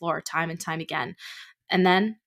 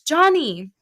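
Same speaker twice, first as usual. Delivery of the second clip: frantic call; 9 semitones higher, 8 dB louder than usual. Acted. Mother calling her son.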